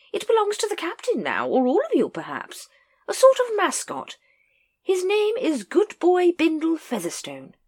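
very high, hearty lady; lady correcting Nobby, very high and hearty. A narrator's reading. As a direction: chirp it like a jolly aristocrat. Lady Ramkin explains mascot.

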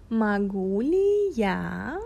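"Могу ли я" said slowly, each word apart; slow it down for a learner. Shown as a demonstration. Slowing the Russian down for the listener, slow demo. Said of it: "I'll say it again, but slower".

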